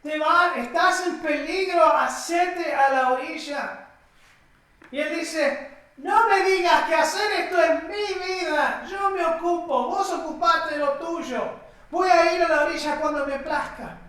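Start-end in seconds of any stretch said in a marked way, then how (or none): 0.0-3.9 acted shout, higher and louder; raised, insistent voice calling out danger; urgent warning. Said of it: "le están advirtiendo". 6.0-14.0 high, raised voice brushing off advice; stubbornly snapping back at onlookers; defiant retort. Retold or imitated. Acted defiant retort to warnings.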